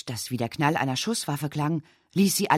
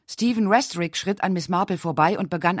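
ordinary delivery

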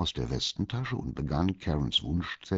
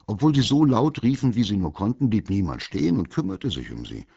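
ordinary delivery

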